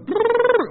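squeaky high-pitched gibberish; high angry babble. Retold or imitated. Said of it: "one goes".

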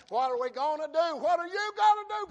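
loud, rising cry to God; desperate pleading. Acted. King asks God what He will do, desperate loud plea. Hezekiah begs God for an answer.

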